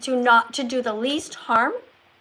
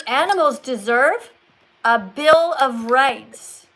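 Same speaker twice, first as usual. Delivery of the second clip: "a Bill of Rights," stretched very slowly and louder; slow, weighty delivery; 5 dB louder, slower than usual. Shown as a demonstration. Coach modelling how to make a phrase sink in, slow. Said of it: "Let that sink into people".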